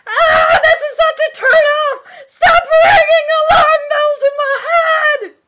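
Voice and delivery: very high, very loud fake-outraged shriek; mock shriek. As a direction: scream it like an appalled heroine, hammed up.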